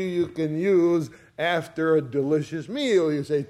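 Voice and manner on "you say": ordinary delivery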